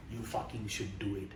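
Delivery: ordinary delivery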